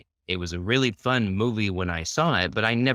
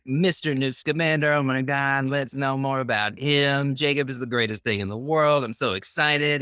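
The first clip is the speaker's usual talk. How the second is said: higher, imitating his own giddy fan self; mock fanboy excitement, higher; retold gushing fan; 7 semitones higher than usual. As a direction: gush like a starstruck fan. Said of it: "I walked away like".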